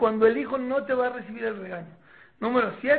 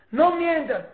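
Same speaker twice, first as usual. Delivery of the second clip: raised, higher-pitched scolding; parental order; 6 semitones higher, 8 dB louder, slower than usual. Acted. Parent forbidding a child to lie, loud stern order. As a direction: lay down the rule loudly.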